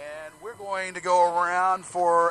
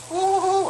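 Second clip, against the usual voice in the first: high ooh; 9 dB louder than usual. Acted. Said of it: "And you go".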